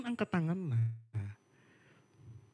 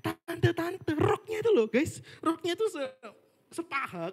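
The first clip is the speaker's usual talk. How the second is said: much higher, scandalised gossip; gossipy high voice; 10 semitones higher than usual. Retold or imitated. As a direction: squeal it like juicy gossip. Gossiping about the aunties' short skirts. (nada tinggi bergosip)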